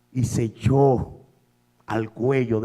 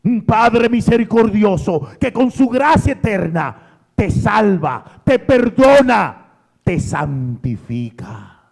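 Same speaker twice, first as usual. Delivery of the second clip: high, loud, half-sung preacher's cry; chanting preacher at full force; intoned preaching climax; 9 semitones higher, 8 dB louder than usual. Acted. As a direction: lift it up like a hymn.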